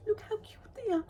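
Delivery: high, gushing squeal; squealing cute voice. Real, not acted. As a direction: coo over something adorable. Gushing over crochet toys.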